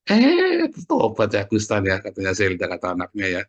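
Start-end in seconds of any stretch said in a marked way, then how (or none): 0.1-0.7 high, loud "eh"; surprised exclamation. Acted. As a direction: burst out in a sudden high cry of surprise. Recalls suddenly good news of Agustan.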